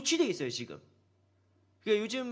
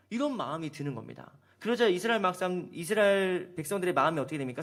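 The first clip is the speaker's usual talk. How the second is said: normal talking voice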